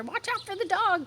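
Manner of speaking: high warning call; cows calling out. A narrator's reading. Cows warning Wilbur about Lurvy, high call. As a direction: warn across the yard. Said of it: "cried the sheep".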